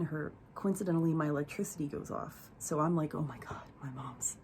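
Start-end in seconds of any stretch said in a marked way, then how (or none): 3.1-4.4 retold near-whisper to oneself; hushed alarm. Caller to aunt as the power goes off, hushed alarm. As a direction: drop to a low, hushed gasp of realization.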